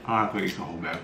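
usual speaking voice

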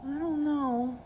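put-on high, hesitant child voice; small boy half giving in; wavering boy. A narrator's reading. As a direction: hesitate as a boy who is tempted. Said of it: "his voice betrayed that he was considering".

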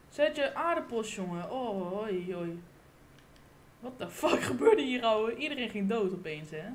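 usual speaking voice

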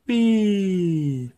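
put-on high, delighted squeal of a spinning child; gleeful whee. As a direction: let it fly up like a happy spin. Spinning ballerina in a picture book.

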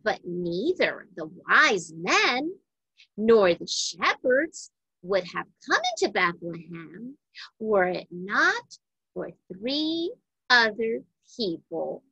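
ordinary delivery, nothing marked

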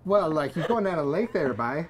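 loud East Coast Canadian patter; Canadian East Coast accent. Shown as a demonstration. Showing host a real East Coast accent, loud Canadian Maritime lilt. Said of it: "do a real Canadian East Coast accent".

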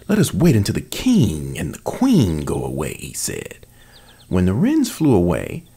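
expressive read-aloud narration; storyteller voice with character lines; storybook reading. A narrator's reading. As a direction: read the fairy tale to an audience. Reads fairy tale aloud as sample.

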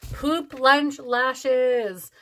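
very slow, deliberate repeat of the name; drawn-out amused repeat; savoured repeat. Real, not acted. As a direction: relish each word.

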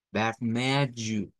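"vermelho" slow, guttural r; slow model. Shown as a demonstration. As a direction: model for repetition. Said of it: "repitan conmigo". ("vermelho" lento para repetir)

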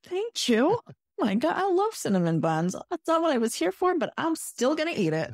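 high, delighted gushing thanks; overjoyed at the free treat. Retold or imitated. Retelling thanks to the cast member, high and gushing. Thrilled at free cinnamon bun.

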